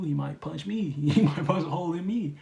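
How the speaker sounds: half-laughing, joking alarm; laughing at their own fear of the man who punched the wall; mock fear. Mock fear of the angry guy.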